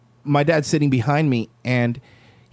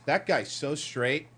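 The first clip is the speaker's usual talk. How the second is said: slow, much softer dad aside; hushed, drawn-out mock remark; sarcastic dad; 11 dB quieter, slower than usual. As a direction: mutter it as dad's sly commentary. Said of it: "my dad couldn't help it".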